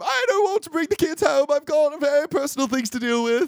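hammed-up high-pitched protest; flustered protest. Reluctant host objecting to taking in kids, hammed-up protest. Acts out Miss Price refusing the kids.

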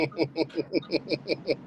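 long rippling chuckle at his own story. Real, not acted.

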